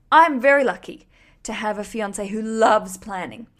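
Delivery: oddly loud, overdone reading; weird voice. Real, not acted. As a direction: read it with a strange overdone lilt. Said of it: "I sounded a bit weird then".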